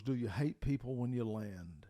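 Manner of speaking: normal talking voice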